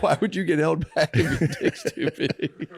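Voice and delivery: giggling through the question; laughing. Retold or imitated. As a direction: laugh while asking. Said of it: "She's like".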